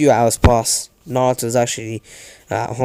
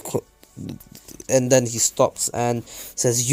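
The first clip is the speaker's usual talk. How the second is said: ordinary delivery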